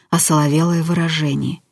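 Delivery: ordinary delivery, nothing marked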